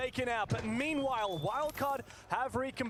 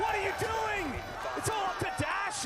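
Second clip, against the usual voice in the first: high, incredulous yell; stunned outcry; 11 semitones higher than usual. Real, not acted. Caster shocked by player's mistake, high outcry. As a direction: shout at the player's blunder.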